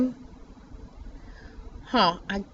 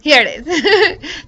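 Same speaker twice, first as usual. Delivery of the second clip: slow laugh through the words; 6 semitones higher, 6 dB louder, slower than usual. Real, not acted. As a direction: laugh as you finally find it. Finds the layer, laughs.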